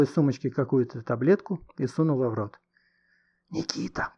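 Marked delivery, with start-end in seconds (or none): 3.5-4.1 soft voiceless whisper. A narrator's reading. Goshka secretly calling his friend, whispering. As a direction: whisper to get his attention. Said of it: "прошептал Гошка".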